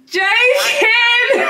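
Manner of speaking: high, loud "joking" yell; excited shout. Real, not acted. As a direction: burst out laughing the reveal. Reveals the prank, shouting.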